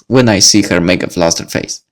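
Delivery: plain reading voice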